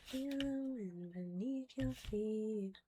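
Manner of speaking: soft, slow ASMR voice. Acted. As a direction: ease them into a calm breath, slowly. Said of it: "like, A S M R voice".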